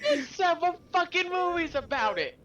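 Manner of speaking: high-pitched incredulous heckling; mock outraged yelling. Hecklers citing movies to the inventors, mock outraged yelling. Both act out crowd heckling.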